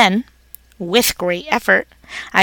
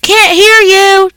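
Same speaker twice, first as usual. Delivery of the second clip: much higher, loud and slow; dad shouting; 11 semitones higher, 8 dB louder, slower than usual. A narrator's reading. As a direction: yell back over the noise. Family shouting over TV.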